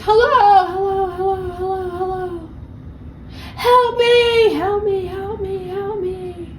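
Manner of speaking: hammed-up echoing cries for help, dwindling; mock cries fading away. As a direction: call up from the hole, then let it die off into the distance. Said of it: "They're screaming from the bottom of the hole".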